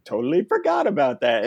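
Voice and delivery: high sudden oh yeah; surprised realization. Retold or imitated. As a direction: act out remembering suddenly. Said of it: "And I said".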